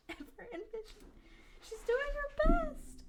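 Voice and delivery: high, giggling through the words; laughing disbelief. Real, not acted. Player laughing at the heroine's blunder, giggly. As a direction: crack up at the character.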